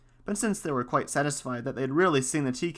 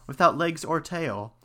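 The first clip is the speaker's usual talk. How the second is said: ordinary delivery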